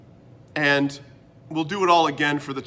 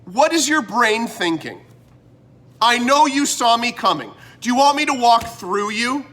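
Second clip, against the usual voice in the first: louder and higher, fed-up scolding; exasperated telling-off; 6 semitones higher, 4 dB louder than usual. Retold or imitated. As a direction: confront the guy blocking the aisle. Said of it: "When I should be saying".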